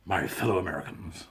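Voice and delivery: a low presidential address; Nixon. Retold or imitated. Imitating Nixon greeting American readers, lightly done. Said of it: "I sound like that bloke. What's it, uh, Nixon?".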